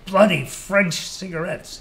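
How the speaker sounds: a Brit complaining half-amused after a cough; British pilot grumbling. A narrator's reading.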